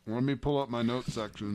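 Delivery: talking through a mouthful; chewing while speaking; mouth full. Real, not acted. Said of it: "what are you talking with your mouth full".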